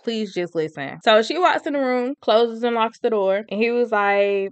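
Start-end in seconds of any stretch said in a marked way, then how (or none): 0.0-1.0 soft, low mock plea; retold begging. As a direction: quote his plea quietly, pitched down. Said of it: "he's begging and crying".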